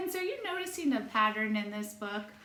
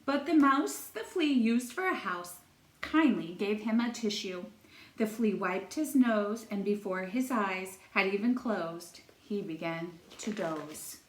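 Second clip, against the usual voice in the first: lilting singsong read-aloud; storytime reading; 3 semitones higher than usual. A narrator's reading. Teacher reading a rhyming book to children, singsong storytelling. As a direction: read it like a picture book for little kids.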